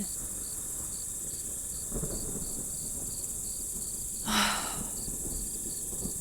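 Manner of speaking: long, breathy exhale of defeat; long weary sigh. Acted. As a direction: let out a long, tired sigh. Fed up, alone at night.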